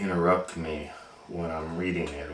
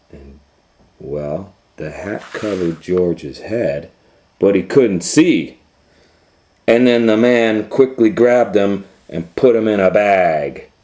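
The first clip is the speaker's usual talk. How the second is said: put-on reading to a child; 9 dB louder than usual. A narrator's reading. Dad reading Curious George to little Leo, lively storybook voice. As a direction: tell the tale so Leo stays hooked.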